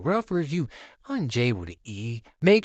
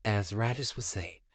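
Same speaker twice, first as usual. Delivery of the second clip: ordinary delivery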